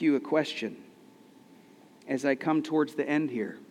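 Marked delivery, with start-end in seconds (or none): none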